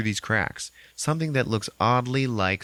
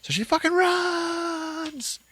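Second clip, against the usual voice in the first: stretched slow, much higher, excited; drawn-out exclaim; much slower than usual. Retold or imitated. Telling a friend about the horror film, slow dramatic exclaim. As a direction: drag out the climax word. Retelling the chase scene.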